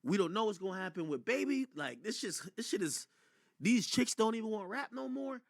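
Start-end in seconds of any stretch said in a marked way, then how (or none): none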